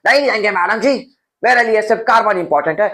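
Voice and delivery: sassy character brushing them off; mock refusal. Teacher voicing a refusing character.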